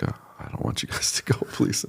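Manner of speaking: softly retelling a tearful plea; hushed, tearful retold plea. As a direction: whisper the plea like a pastor near tears. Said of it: "And he's in tears".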